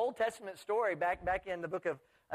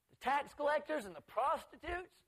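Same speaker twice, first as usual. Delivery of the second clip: high, drawn-out disbelieving question; acted scandalized disbelief, pitch jumping up; incredulous; 9 semitones higher than usual. Scandalized listener questioning who gets into heaven, incredulous.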